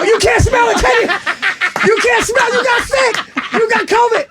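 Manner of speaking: high, loud, laughing gloat; gleeful gloating. Retold or imitated. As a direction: crow with delight at being proven right. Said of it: "And I go".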